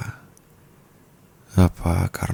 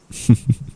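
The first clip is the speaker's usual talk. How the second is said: short breathy laugh; a quick teasing laugh; light airy chuckle; amused chuckle; 8 semitones higher, 5 dB louder than usual. Acted. Teasing partner.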